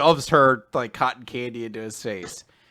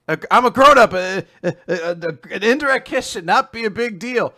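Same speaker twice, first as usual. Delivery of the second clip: high, loud, stammering protest; hammed-up fluster, tripping on words; upset, flustered; 7 semitones higher, 9 dB louder than usual. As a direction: stumble through mock-panicked self-reassurance. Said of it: "he actually is really upset".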